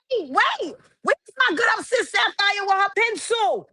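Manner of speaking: sudden high-pitched excitement, calling out; high excited call; excited outburst. Real, not acted. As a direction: jump up high calling for her sis. Excited interruption looking for someone.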